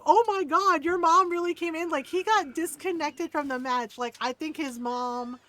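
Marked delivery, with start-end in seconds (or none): 0.0-1.9 high, loud mock disbelief; imitated shocked streamer. Streamer hearing kid's mom came in, mock shock. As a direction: exclaim it high and loud, astonished. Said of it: "Cheer Girl's like".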